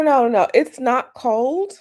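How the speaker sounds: usual speaking voice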